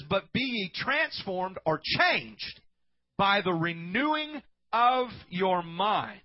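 slow, weighty Bible recitation; solemn scripture reading. Acted. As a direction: read the verse slowly and loudly. Reads scripture slowly.

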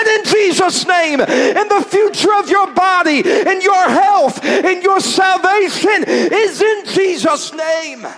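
high, loud, shouted preaching; preaching at full force. Acted. Preacher declaring the church's future, shouting. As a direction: build to a roaring close.

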